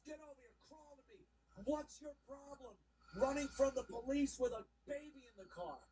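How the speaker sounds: mock the cop ordering her over, then scolding; lightly voiced stern cop. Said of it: "And the cop goes".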